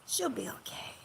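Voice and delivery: breathy whisper, almost no voice. Real, not acted. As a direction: whisper it reassuringly. Repeats it whispered.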